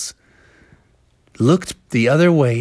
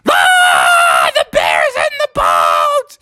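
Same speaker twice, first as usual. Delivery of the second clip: hammed-up panicked yell, drawn out; rough, slow cry of fright; spooked fishermen; 9 semitones lower, much slower than usual. A narrator's reading. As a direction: scream the alarm like a spooked storybook sailor. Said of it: "the fishermen were so spooked".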